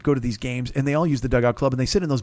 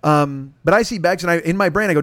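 normal talking voice

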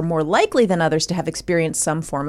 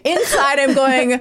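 laughing; 5 semitones higher, slower than usual. Real, not acted. Laughing while recounting the scene.

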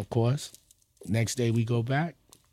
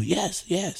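eager yes; 6 semitones higher, 6 dB quieter than usual. Retold or imitated. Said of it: "I almost jumped across the table".

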